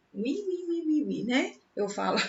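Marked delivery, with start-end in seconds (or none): 0.1-1.2 high, soft piggy "we"; little piggy squeal. Shown as a demonstration. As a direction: squeal it lightly like a piglet. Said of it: "pronúncia do porquinho". (gritinho agudo de porquinho)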